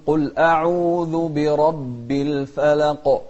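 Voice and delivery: loud, slow chanted "الفلق" ending on a bouncing qaf; qalqalah. Shown as a demonstration. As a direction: recite strongly, popping the final qaf of "الفلق". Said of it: "نوضح القاف". (ترتيل جهوري مع قلقلة قاف "الفلق")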